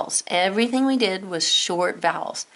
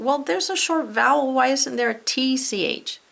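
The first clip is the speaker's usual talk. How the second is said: imagined student question; 6 semitones higher than usual. Retold or imitated. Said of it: "some of you might be saying".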